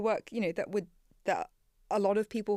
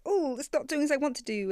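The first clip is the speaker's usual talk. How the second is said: mock frustration; 7 semitones higher, 5 dB louder than usual. Mock frustrated complaint about Claude.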